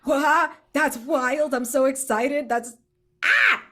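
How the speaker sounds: high, loud excited yelps; squealing with glee. Real, not acted. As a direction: gush with delight about time loops. Excited about time loops.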